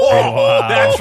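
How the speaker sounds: high squealing laugh into the words; burst of laughter. Real, not acted. Host cracking up at the caller's confession.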